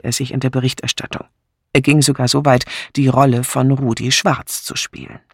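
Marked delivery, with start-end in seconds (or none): none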